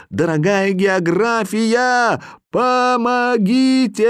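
shouting for help. A narrator's reading. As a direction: cry out to geography. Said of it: "и я крикнул".

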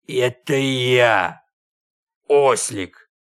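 low, slow beastly drawl; donkey startled into a slow wolfish voice; strange wolfish voice. A narrator's reading. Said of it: "удивился своему голосу".

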